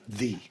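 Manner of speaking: The with a long EE vowel; stressed The, rhyming with see; emphatic THE. Shown as a demonstration. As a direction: say The as "thee". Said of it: "it's the only one".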